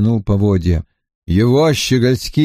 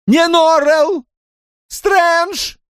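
frightened Drawlight; much slower than usual. A narrator's reading. Drawlight correcting Lascelles, high and alarmed. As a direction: cry the name out.

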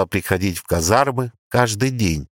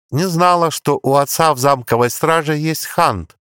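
sarcastic; 7 semitones higher than usual. A narrator's reading. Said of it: "с долей сарказма утвердила она".

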